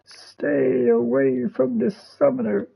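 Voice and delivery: slow, drawn-out whispery mimicry; mimicking her warning. Player imitating the woman guarding the summoner, slow whispery mimicry. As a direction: stretch it out in a high, whispered imitation. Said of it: "It's just the way she says it".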